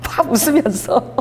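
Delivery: words dissolving into laughter; imitated laughing. Commentator retelling a prayer he found funny, laughing. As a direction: talk through a breaking laugh. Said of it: "막 웃으면서 이렇게 얘기하는 거예요".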